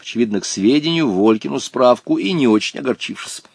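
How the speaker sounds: usual speaking voice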